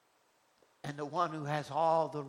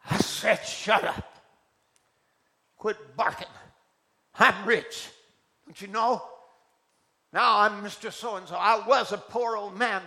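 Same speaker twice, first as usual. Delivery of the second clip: annoyed scolding; 5 semitones higher, 9 dB louder than usual. Acted. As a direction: snap at the dog then boast. Miner scolds dog, boasting.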